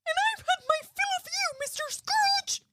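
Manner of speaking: very high squealing diva; Miss Piggy voice. Retold or imitated. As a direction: spit it back like a furious pig diva. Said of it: "Mrs Cratchit answering Scrooge".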